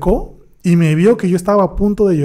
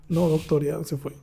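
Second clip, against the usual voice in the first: quiet, half-choked reply trailing off; voice breaking; 9 dB quieter than usual. Retold or imitated. Student telling his doctor the patient walked out, voice breaking. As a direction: answer the doctor holding back tears, voice cracking and fading. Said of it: "medio con la voz quebrada le digo".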